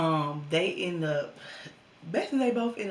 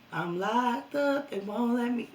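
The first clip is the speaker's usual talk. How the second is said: excited; 7 semitones higher, 6 dB louder, slower than usual. Retold or imitated. Joking about jail.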